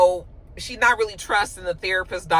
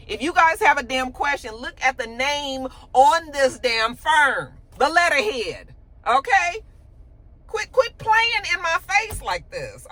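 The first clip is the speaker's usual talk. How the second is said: rising high, slow telling-off; fed up; 7 semitones higher than usual. Retold or imitated. Jax scolding the partners, high and slow fed-up. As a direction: put them in their place.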